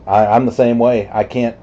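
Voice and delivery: ordinary talk, nothing marked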